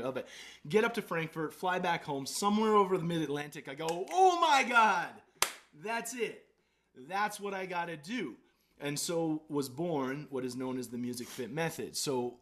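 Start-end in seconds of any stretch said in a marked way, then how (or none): 4.1-8.4 high, loud eureka; sudden realization. Retold or imitated. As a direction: it suddenly dawns on him. Mid-flight realization.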